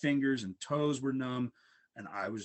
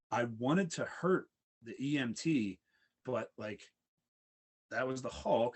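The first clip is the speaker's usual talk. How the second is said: ordinary talk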